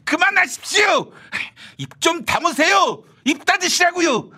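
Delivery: indignant shout. Acted. Host shouting him quiet.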